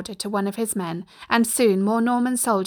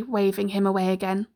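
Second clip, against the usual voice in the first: plain reading voice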